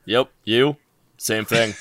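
ordinary talk

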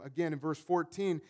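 ordinary delivery

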